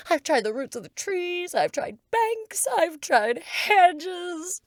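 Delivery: a frantic list of grievances; a high, aggrieved pigeon. Acted. Pigeon complaining.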